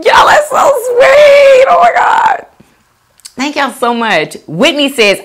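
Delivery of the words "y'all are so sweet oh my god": overwhelmed cry-shout. Real, not acted. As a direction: cry out Y'all are so sweet, near tears. Overwhelmed by compliments.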